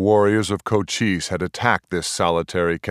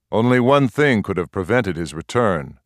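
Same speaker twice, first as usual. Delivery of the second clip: plain reading voice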